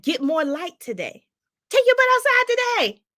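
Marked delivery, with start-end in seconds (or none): 1.7-3.0 high, loud, fast sassy order; playful command. Real, not acted. Host ordering viewers outdoors, high and fast. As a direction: boss them outside.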